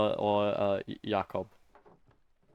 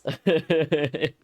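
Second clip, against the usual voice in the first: laughing; 7 semitones higher, 6 dB louder than usual. Real, not acted. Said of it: "laughs at own voice".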